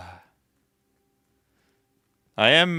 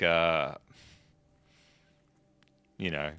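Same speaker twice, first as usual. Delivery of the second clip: ordinary talk, nothing marked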